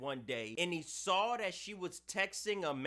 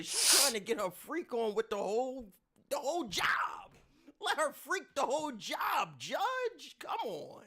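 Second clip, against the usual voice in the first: excited protest; 7 semitones higher, 3 dB louder than usual. Real, not acted. Protesting the judge, voice building.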